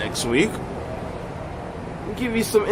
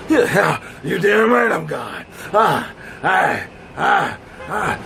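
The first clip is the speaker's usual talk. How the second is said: lightly voiced caveman grunts; mock caveman sex noises; slower than usual. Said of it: "Sound like a damn caveman".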